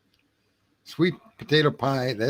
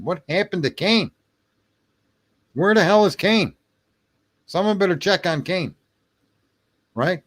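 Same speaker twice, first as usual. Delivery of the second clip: high, raised, agitated call; mock alarm; 7 semitones higher, 3 dB louder than usual. Streamer calls for someone to check on Kane, mock alarm. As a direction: demand answers as if someone's gone missing.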